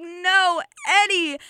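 hammed-up cry for a stricken friend; anguished cry. Richie calling to his stabbed friend, mock anguish.

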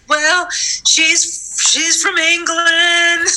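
high, breezy mock-grand voice, slow on England; airy grand lady. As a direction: play the vague, self-important star. Mocking her clueless early self.

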